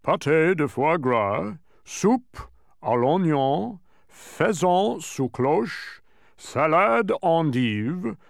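slow, deliberate French menu recital; careful pronouncing. A narrator's reading. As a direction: pronounce each dish carefully, drawing it out. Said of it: "he said carefully".

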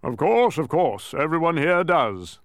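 impatient royal grunt; grunting king. A narrator's reading. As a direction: brush it off as a gruff king. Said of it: "the king grunted".

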